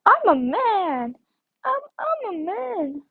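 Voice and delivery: high, drawn-out playful wail; mock exclaiming. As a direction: stretch it out slow and high, mock-dramatic. Mock outburst on seeing man avatar.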